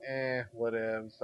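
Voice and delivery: a quiet, drawn-out apathetic mumble; defeated game director. Acted. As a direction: shrug it off softly and slowly, mildly mock-defeated. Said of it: "wouldn't want a defeated game director".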